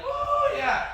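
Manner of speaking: high, loud whoop of joy; excited kid. Retold or imitated. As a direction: cheer like a kid with a new toy. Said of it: "I'm all excited, like".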